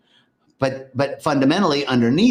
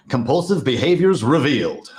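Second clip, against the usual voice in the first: laughing, raised-voice topic reveal; laughing announcement. Real, not acted. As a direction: blurt out "compulsive behaviors revealed" through a laugh.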